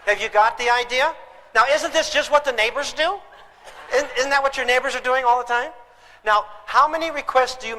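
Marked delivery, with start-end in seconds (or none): none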